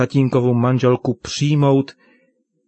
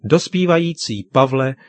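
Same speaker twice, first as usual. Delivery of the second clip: ordinary delivery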